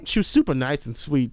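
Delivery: usual speaking voice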